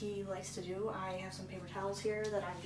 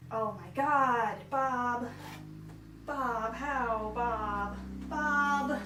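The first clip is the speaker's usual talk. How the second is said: exasperated outcry; 6 dB louder, much slower than usual. Real, not acted. Exasperated at Bob's speed.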